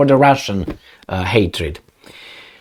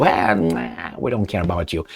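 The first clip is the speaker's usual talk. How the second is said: dismissive; 8 semitones lower, 10 dB quieter than usual. Acted. Acted dismissive neighbors.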